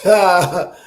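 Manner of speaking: loud burst of laughter; laughing. Real, not acted. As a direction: laugh it off heartily. Laughing at the Bears.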